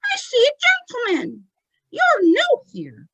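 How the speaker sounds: high, deliberate ventriloquist voice; childlike falsetto, slowing at the end; Tommy. Acted. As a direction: announce it as a squeaky medium's spirit. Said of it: "And then he says".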